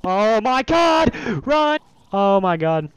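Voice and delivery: high, loud panicked shout; terrified. Real, not acted. Player surprised by a swamp pig thing, terrified shout. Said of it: "that actually terrified me".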